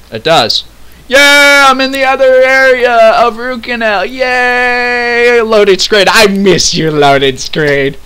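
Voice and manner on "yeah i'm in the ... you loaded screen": mock-celebratory cheer, drawn out; sarcastic. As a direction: gush with fake delight at a higher pitch. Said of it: "that's some sarcasm there".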